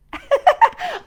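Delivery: loud high laugh; burst of high laughter. Real, not acted. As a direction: break into a real laugh. Laughs after the rooster call.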